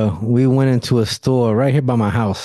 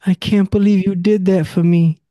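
teary wife; 8 semitones higher than usual. Retold or imitated. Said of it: "she got teary".

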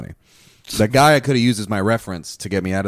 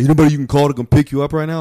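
quoted cop; 6 semitones higher, 8 dB louder, faster than usual. Retold or imitated. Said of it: "he's like".

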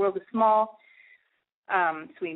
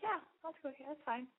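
soft easygoing agreement; 6 semitones higher, 15 dB quieter than usual. Retold or imitated. Retold gentle acceptance.

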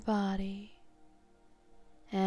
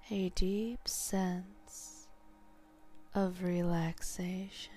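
slow whispered echo; 3 dB quieter, slower than usual. Shown as a demonstration. Hypnotist echoing the suggestion, slow whisper. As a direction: echo it back, slower and breathier. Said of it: "leaving behind a deep sense of relaxation".

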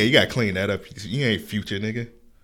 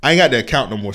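ordinary talk, nothing marked

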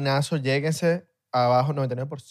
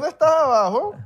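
high mock voice; 11 semitones higher, 6 dB louder than usual.

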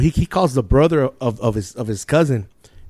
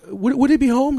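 high put-on British inquiry; polite fake-British caller; British accent; 11 semitones higher than usual. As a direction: ask it in a mock British phone voice. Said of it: "I did it like a British accent".

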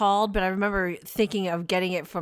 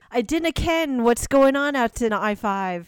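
mock Scottish; 4 semitones higher, 4 dB louder than usual. Mock Scottish traffic report.

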